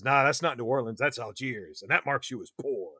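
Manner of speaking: lightly voiced smooth devil talking down; a hint of a coaxing demon; Darkling voice. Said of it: "The Darkling says".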